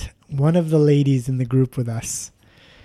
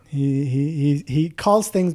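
ordinary talk, nothing marked

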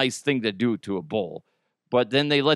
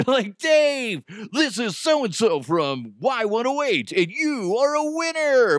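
high-pitched, over-the-top prize announcement; hyped radio host; 10 semitones higher than usual. Retold or imitated. Prank caller tells Dave he has won, hyped radio host. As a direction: sell the fake win like a contest DJ.

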